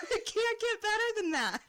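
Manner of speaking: high, loud, laughing through words. Real, not acted. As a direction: burst out laughing mid-sentence.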